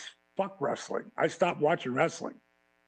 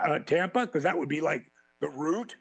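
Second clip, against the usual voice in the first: ordinary talk, nothing marked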